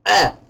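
sharp high hiccup yelp; loud hiccup. Real, not acted. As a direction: violent hiccup burst. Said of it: "they're actually causing chest pain".

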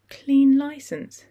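slow, stunned echo of the words; numb voice. Acted. Susie echoing the bad news in shock, numb. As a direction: repeat it as if the news hasn't landed. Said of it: "repeated in a numb voice".

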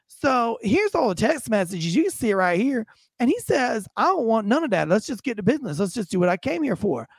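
theatrical storytelling with swooping pitch; nonchalant suspect. Retold or imitated. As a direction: imitate an unbothered man explaining himself to police. Plays suspect telling his side.

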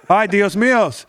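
"Ay, Dios míos" high, hammed Spanish accent; mock Spanish accent. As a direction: exclaim in a fake Spanish accent.

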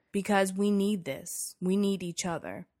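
ordinary talk, nothing marked

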